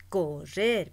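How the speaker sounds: loud, stretched RRER in Correr; stress on last syllable. Shown as a demonstration. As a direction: lean hard on the end of Correr. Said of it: "se pronuncia en la última sílaba". (acento fuerte en la RRER de Correr)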